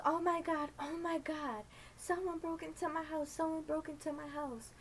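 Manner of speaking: high, frantic retold panic; panicked neighbor. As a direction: squeal it out like a scared old lady.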